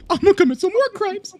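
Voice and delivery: high, loud cartoon hedgehog voice; squeaky hero impression; Sonic voice. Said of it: "'Cause all you hear is".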